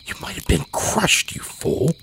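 sharp whispered hiss; hissed warning. Acted. Woodcutter hisses at the child, whispered scolding. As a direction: hiss it through your teeth. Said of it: "to hiss".

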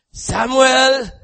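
a high, loud call of a name; calling in Eli's voice. Acted. God calling Samuel in old Eli's voice, high and loud. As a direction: call the boy's name out in the night. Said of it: "in the voice of Eli".

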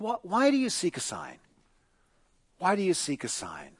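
soft, gentle questioning; patient. Acted. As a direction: ask it quietly, with acted patience. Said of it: "Jesus is far more patient".

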